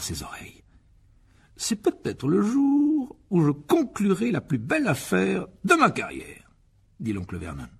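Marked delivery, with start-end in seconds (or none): 1.6-6.5 high, slow, grandly drawn-out boast; pompous uncle. Acted. Uncle boasting of the biggest deal of his career, pompous. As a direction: savour the big announcement, stretching the words.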